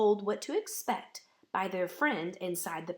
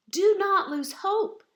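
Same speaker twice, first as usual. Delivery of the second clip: raised, louder call to hope; rousing encouragement, higher and louder; stirring; 11 semitones higher, 8 dB louder than usual. Acted. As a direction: lift it high and ring it out. Bellini encourages Mirette.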